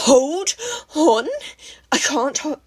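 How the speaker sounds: very loud incredulous yell; near scream. A narrator's reading. Katsuki nearly screaming at Izuku, furious. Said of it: "Katsuki almost screamed".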